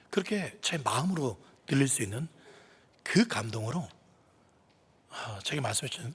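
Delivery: ordinary talk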